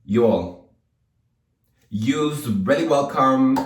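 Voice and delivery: slow, loud amateur Jersey drawl; attempted Jersey accent. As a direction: lean into a mock Jersey accent. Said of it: "what's a Jersey accent?".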